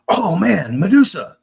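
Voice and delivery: high, loud excited exclamation; retold excitement. Guy arriving at the party, excited. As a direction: burst in, mimicking an excited guy.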